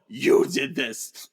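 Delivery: drawn-out, very slow you did this; slow accusation. Retold or imitated. Xavier turning the blame back on Eric, slow accusation. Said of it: "But Xavier says".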